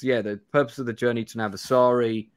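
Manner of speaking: ordinary delivery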